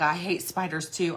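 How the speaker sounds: ordinary talk, nothing marked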